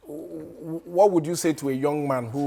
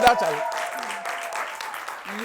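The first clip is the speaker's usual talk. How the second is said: drawn-out call; 6 semitones higher, much slower than usual. Acted. Stretched call to the guest.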